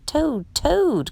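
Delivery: mild storybook cry. A narrator's reading. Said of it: "cried Frog".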